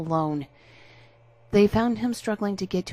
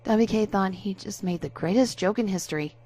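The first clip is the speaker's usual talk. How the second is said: plain reading voice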